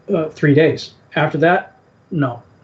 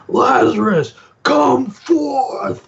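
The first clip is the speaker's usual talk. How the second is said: high, soaring mock summons; crescendo call; 10 semitones higher, much slower than usual. Jesus summoning Lazarus at the song's crescendo, high dramatic call. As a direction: imitate the song's climax, pitched far up. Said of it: "builds up to the crescendo of the song".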